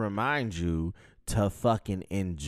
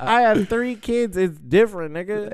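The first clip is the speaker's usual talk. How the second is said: indignant; 11 semitones higher, 10 dB louder than usual. Real, not acted. Heated reply about his kids.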